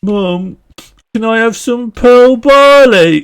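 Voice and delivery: high, loud, drawn-out Brummie plea; Brummie accent. Pretend. Child begging mum for dinner, loud high Brummie accent. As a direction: wail it like a Brummie kid. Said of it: "doing a Brummie accent asking for".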